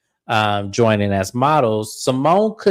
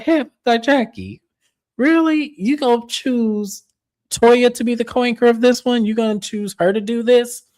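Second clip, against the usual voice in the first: imitating incredulous Heavenly; 10 semitones higher than usual. Retelling Heavenly's disbelief at Jackie.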